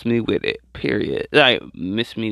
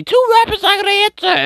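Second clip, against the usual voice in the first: much higher and fast, disbelieving; incredulous squeal; 4 dB louder, faster than usual. Real, not acted. Incredulous retelling of interview.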